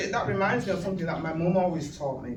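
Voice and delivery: ordinary delivery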